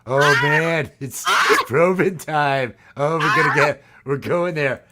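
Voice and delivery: loud hyped-up yelling, laughing; excited shout. Acted. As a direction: shout it out, thrilled. Hyped alien probing bit.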